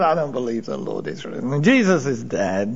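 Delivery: low, quiet, dismissive refusal; flat disbelief. Acted. Thomas rejecting the disciples' news, low and quiet. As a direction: shut the idea down flatly.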